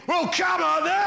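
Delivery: mock-defiant shout, pitched well up; defiant Londoners. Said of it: "a room full of Londoners, we're all thinking".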